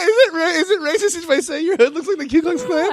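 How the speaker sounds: high, loud, talking through laughter; barely getting words out laughing. Real, not acted. Cracking up mid-question.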